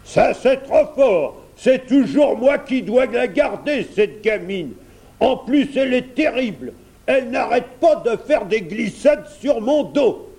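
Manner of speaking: high-pitched dragon grumbling, forceful; indignant dragon. A narrator's reading. Dragon complains about minding the princess, indignant. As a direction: complain bitterly as a storybook dragon.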